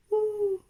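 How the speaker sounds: high, loud owl hoot. Acted. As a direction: call out like a swooping owl. Owl floating down.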